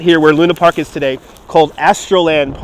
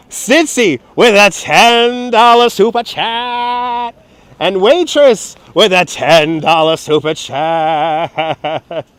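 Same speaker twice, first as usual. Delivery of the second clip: announcer; much slower than usual. Acted. Announcing superchats.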